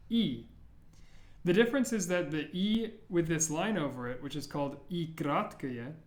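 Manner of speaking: normal talking voice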